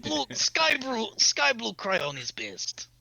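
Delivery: put-on slow, high Russian-accented brute; slow Russian accent, savoring each word. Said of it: "you do not have to do the Russian accent".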